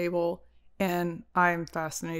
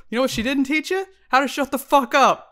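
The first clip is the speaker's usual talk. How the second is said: loud, higher mock outburst; mocking quote; 5 semitones higher, 7 dB louder than usual. Girlfriend quoting boyfriend's rude joke back, loud mockery. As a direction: throw his line back loudly. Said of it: "And you go".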